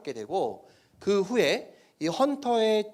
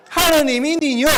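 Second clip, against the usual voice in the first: high, loud North Korean dialect; 9 semitones higher, 8 dB louder than usual. Shown as a demonstration. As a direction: read it in a strong northern dialect. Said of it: "이렇게 하죠".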